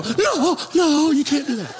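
high alarmed protest; women protesting. Retold or imitated. Church women stopping him from attacking the pastor, high alarmed cry. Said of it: "And all the women go".

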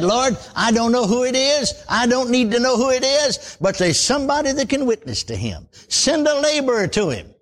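earnest plea to the Lord for a laborer; retold prayer. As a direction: pray it earnestly, reaching upward. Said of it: "I said".

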